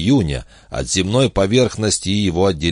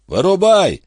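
high, raised-voice command; high-pitched called-out order; shouting; 13 semitones higher, 4 dB louder than usual. Acted. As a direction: yell the order up to someone far away. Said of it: "закричал Гард".

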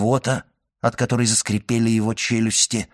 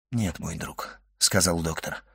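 soft, lowered voice; gentle consoling; 6 semitones lower, 8 dB quieter than usual. A narrator's reading. Doctor comforts the dying servant, gently hushed. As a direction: console him quietly.

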